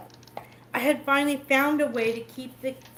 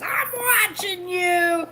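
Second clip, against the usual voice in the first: movie quote; 9 semitones higher, 7 dB louder than usual. Retold or imitated. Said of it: "when he says".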